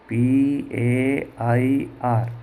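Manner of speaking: very slow, letter by letter; each letter held apart; spelling out. Shown as a demonstration. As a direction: spell it out slowly for a class. Teacher spells the word out.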